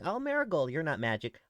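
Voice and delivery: high, loud mock reply. Marigold imitating Iris's doubting reply, high and loud. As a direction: mimic the listener's doubt. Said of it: "I'm sure you're probably thinking".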